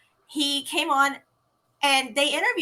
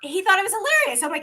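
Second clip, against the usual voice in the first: ordinary delivery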